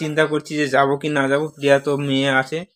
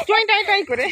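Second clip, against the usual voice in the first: imitated car horn. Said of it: "গাড়ি ... দৌড়াচ্ছি".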